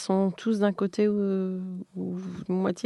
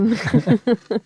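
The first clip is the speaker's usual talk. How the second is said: short laugh; 3 semitones higher, 7 dB louder than usual. Real, not acted. Laugh after the teaching question.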